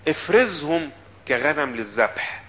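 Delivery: slow, deliberate, every word weighed; slow pleading quote. Acted. Preacher voicing Jeremiah asking God, slow and deliberate. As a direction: drag out the prophet's plea to God.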